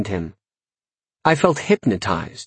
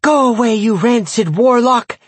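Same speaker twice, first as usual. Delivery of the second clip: high, loud put-on child voice; shrill-pitched kid taunting at a yell; jeering children; 13 semitones higher, 4 dB louder than usual. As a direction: drive the warlock away with a childish chant. Children jeer at the warlock.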